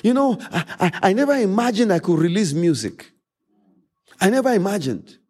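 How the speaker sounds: usual speaking voice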